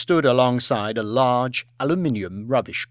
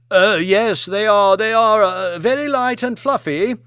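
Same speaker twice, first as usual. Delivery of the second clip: nervous agreement; 8 semitones higher, 6 dB louder than usual. Acted.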